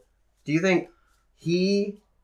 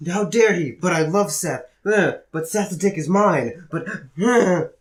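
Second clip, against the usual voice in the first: higher, louder mock outrage; hammed-up jealous rival; 7 semitones higher, 6 dB louder, slower than usual. Jealous rival lamenting over Seth, hammed-up outrage. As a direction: wail it like a wronged lover.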